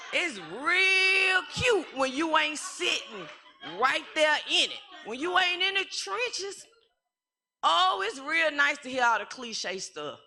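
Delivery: mock the cute cliches with a high laugh; sarcastic. Real, not acted. To the congregation, mocking pious cliches with laughing sarcasm.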